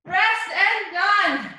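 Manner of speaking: energetic coach cheering. Real, not acted. Pushing class at finish.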